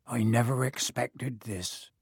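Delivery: low, slow, worried murmur. A narrator's reading. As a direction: say it softly, slowed by worry. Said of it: "in a low, worried voice".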